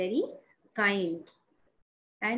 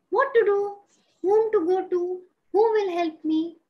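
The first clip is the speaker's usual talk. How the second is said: worried; 5 semitones higher, 6 dB louder than usual. Acted. Firefly at a loss for help.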